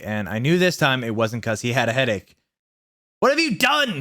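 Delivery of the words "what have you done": very high mock-tearful old man; crying wisely. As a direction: wail it like a grieving wizard. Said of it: "he started to cry wisely".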